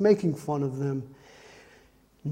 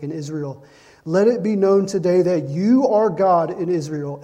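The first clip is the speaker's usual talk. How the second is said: scripture reading; 5 dB louder than usual. Acted. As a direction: proclaim the passage to the congregation. Resumes reading Elijah's story and prayer.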